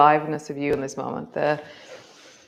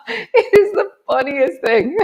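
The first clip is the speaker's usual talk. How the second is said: high, helpless laughter through the words; laughing hard; 6 dB louder than usual. Real, not acted. Teacher telling class about cold sea swims, laughing hard. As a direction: laugh it out, can barely speak.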